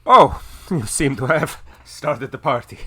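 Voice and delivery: loud, high-pitched character voice; Davos. Acted. Davos arriving amid the chaos, loud exclamation. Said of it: "and he's going".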